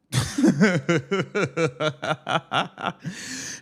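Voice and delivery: real burst of laughter; laughing at the fan's prediction. Real, not acted. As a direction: let a rising laugh roll out. Laughs at the foul-trouble call.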